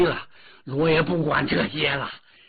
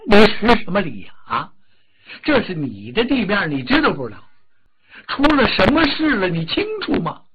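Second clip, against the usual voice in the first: louder, fast angry questions; angry scolding; 3 semitones higher, 6 dB louder than usual. Acted. The Dragon King berates the Earth God, furious.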